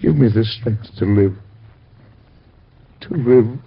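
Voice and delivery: grieving father praying for strength; prayer. Acted. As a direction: beg God for strength. Father's prayer over son.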